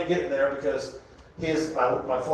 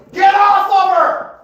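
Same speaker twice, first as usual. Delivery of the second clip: shrill squawking bird voice, very high and loud; a parrot hollering at the couple; screeching parrot; 7 dB louder, slower than usual. Retold or imitated. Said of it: "The bird hollers".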